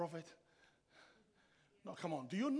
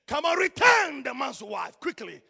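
loud, slow command; forceful divine order; stern rebuke; 4 semitones higher, 11 dB louder than usual. Acted. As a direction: rebuke him in God's voice, hitting it hard. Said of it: "God rebuked that man".

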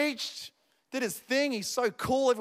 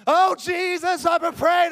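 higher-pitched burst of acted prayer; fervent prayer; 6 semitones higher, 4 dB louder, slower than usual. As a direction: cry out to Jesus in mock fervour. Acts out bursting back into prayer.

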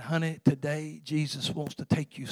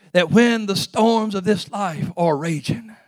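ordinary delivery

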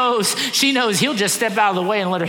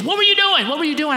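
high, louder outraged yell; indignant little girl caught off guard; mad daughter; 10 semitones higher, 4 dB louder than usual. Retold or imitated. Daughter yelling at dad after he stepped back, outraged. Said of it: "she gets so mad at me. She goes".

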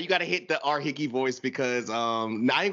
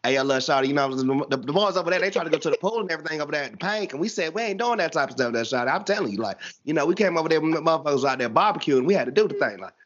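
down south Atlanta. Shown as a demonstration. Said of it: "stereotypical down south Atlanta voice".

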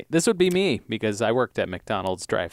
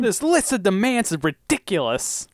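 high-pitched, exasperated complaint; mock indignation; 7 semitones higher than usual. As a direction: protest as if personally wronged, hammed-up. Mock outrage at principal's demands.

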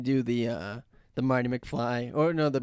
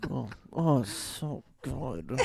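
contented brontosaurus voice; 3 dB quieter, slower than usual. Retold or imitated. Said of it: "so they're like".

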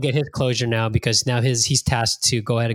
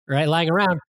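normal talking voice

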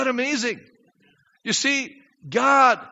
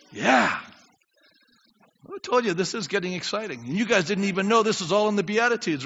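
ordinary delivery

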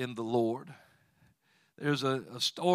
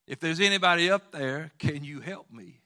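man calling up again; 4 semitones higher, 7 dB louder than usual. Retold or imitated. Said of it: "The man said".

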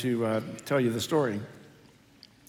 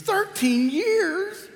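put-on high, slow, stunned old woman's voice; astonished elderly mother; much slower than usual. Pastor voicing his mother hearing how long she's been there. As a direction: stretch it out in disbelief.

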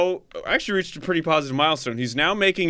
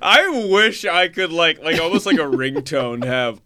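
ordinary delivery

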